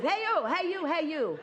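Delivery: high mock calling out; everybody hollering at each other; mock hey-youing. People calling out at one another, high mock yell. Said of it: "all of you are just hey-youing".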